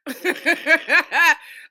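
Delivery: loud, high burst of laughter. Real, not acted. Speaker cracking up after admitting being queer, loud laughter. Laughing at own blunt admission.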